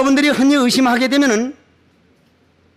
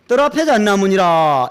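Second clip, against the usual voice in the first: Zen master Joju; 3 semitones lower than usual. Retold or imitated. Zen master answering a seeker, intoned pronouncement. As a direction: answer like an old master, stretching "잣나무니라". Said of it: "조주고불이라고 하는 부처님을 떡 찾아가서".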